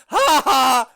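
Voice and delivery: very loud, high fake laugh; booming "ha ha"; triumphant shouted cackle. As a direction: blast out a mock-triumphant laugh. Crowing after the prank.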